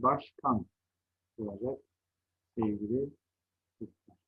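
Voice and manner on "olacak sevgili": ordinary delivery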